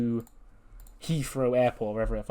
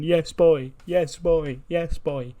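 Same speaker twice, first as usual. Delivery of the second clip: higher, bouncing celebratory chant; mock crying with joy; 5 semitones higher, 4 dB louder, much slower than usual. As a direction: repeat it like two pals jumping and hugging. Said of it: "they're both crying with joy".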